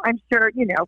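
usual speaking voice

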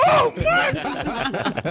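high, drawn-out, delighted Oh Mike; a woman charmed by Mike, gushing; swooning woman. Retold or imitated. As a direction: melt at his line like a smitten woman. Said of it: "They be like".

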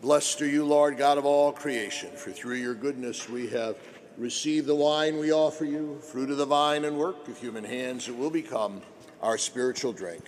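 solemn liturgical prayer voice. Acted.

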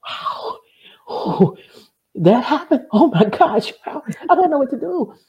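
high, overwhelmed wow with a laugh; acted-out amazement. Acts out reaction to others' stories.

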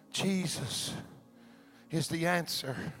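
intimate low preaching. Acted. Pastor reassuring the congregation, low and soft. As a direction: bring it down and lean in close.

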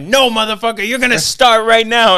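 loud, high retold shout; yelling. Angry customer ordering salesman to start, retold yelling. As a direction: cut in at full volume, imitating an irate customer. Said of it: "like, yelling at me".

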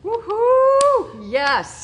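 excited cheer. Real, not acted. Host cheering the guest's double contract, excited whoop. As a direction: cheer like your friend just won.

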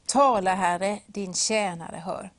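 slow, solemn words to God; the boy answers God, slow and devout; slow reverent answer. Acted. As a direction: answer the Lord slowly and humbly. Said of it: "Så han säger".

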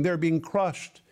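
ordinary delivery

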